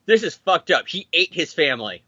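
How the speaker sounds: ordinary talk, nothing marked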